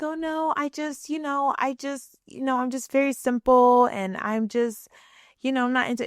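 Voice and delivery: nervously backpedalling, voice up high; embarrassed high-pitched stammer; flustered high voice. Retold or imitated. Flustered reply to cousin's question.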